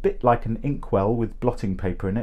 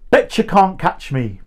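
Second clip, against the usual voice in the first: shouted; 6 semitones higher than usual. A narrator's reading. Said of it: "Peter shouted into the wind".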